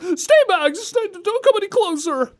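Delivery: high mock panicked plea; mocking imitation. Host mocking the game line, high and hammy. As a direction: parrot the bad line up high. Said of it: "the person who was like".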